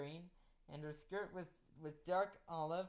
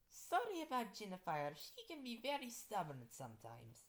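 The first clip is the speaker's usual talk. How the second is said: mildly apologetic, higher character voice; 6 semitones higher than usual. Acted. Skeleton girl excuses stubborn Jennifer to the teacher, apologetic. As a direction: apologize on a friend's behalf, pitched up. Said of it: "Skeletor said apologetically".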